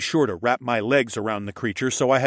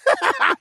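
high, loud unhinged cackle; off-kilter villain laughter; 6 dB louder than usual. A narrator's reading. Villain laughs at the wounded hero, high and off-kilter. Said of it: "high-pitched and off-kilter laughter".

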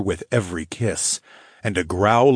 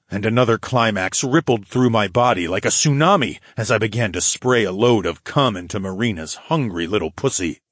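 ordinary delivery, nothing marked

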